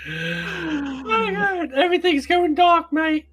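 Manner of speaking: mock-panicked high voice; high silly voice. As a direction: squeak out the panic as a joke. Laughing bit, voice goes high.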